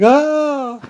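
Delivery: high loud mock yelp; startled cry. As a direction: squeal it like a startled Frenchman. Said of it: "the French was like".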